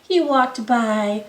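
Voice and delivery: usual speaking voice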